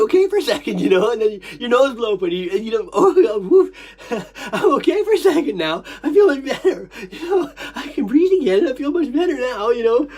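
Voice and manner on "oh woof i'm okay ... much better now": lightly put-on higher voice; a hint of high-pitched relieved whine; acted relief. Speaker acting out relief after blowing nose, acted relief.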